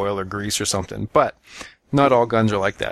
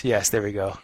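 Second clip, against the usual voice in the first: normal talking voice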